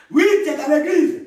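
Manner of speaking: high, loud burst at the congregation; preacher's challenge. Real, not acted. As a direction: call them out, pitched well up. (interpellation forte de prédicateur)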